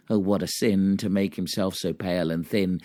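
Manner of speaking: ordinary delivery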